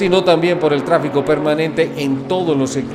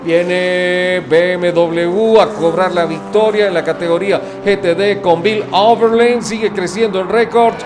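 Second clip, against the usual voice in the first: motorsport commentator; 3 semitones higher than usual. Acted. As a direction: call the checkered flag with rising excitement. Finish-line race call.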